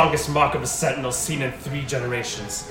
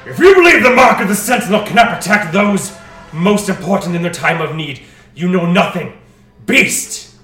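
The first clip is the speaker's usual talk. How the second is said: loud, higher defiant shout; strong male voice; 10 semitones higher, 23 dB louder than usual. Acted. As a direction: hurl it at the beast. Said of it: "a strong male voice".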